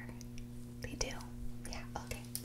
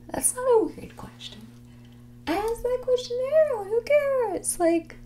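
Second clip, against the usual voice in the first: defensive protest; 4 dB louder than usual. Acted. Defensive reply on the date.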